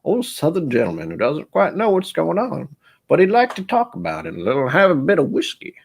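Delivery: high, lightly put-on Southern gent; a hint of an old Southern colonel, pleased with whiskey; old Southern gentleman voice. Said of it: "It's like this old Southern".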